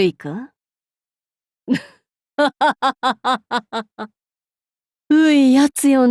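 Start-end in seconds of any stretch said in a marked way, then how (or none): 2.4-4.1 haughty chuckle at a timid retainer; amused laugh. Acted. Demon lord amused by master.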